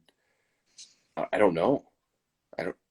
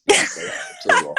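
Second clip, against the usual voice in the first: mock swooning yes; 5 dB louder than usual. Mock accepting fan proposal.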